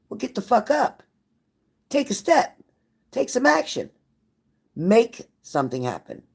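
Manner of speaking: fired-up push. Acted. Pushing viewer to act.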